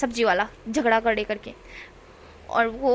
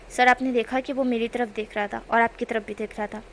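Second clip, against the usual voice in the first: plain reading voice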